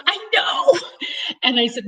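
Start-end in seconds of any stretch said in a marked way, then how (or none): 0.1-0.8 excited reply. Retold or imitated. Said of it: "And she goes".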